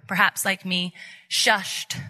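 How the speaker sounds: ordinary delivery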